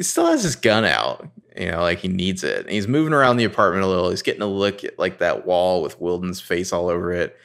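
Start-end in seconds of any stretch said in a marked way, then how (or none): none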